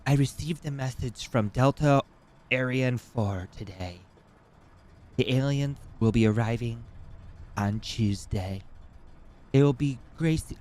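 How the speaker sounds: deadpan prophecy in a faintly put-on voice; mock alien believer. Streamer mimics a TikTok lady announcing alien arrival, lightly solemn. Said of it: "She's like".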